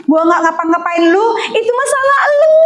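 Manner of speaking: high, whiny mock devil's protest; the devil replying. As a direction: play the devil pleading innocent. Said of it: "Padahal kata iblis".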